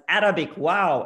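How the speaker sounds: "Arabic, wow" with her dad's Arabic accent, Arabic stressed and loud. Shown as a demonstration. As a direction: say "Arabic, wow" the way her Arabic-speaking dad would. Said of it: "when I try to say it with his accent as".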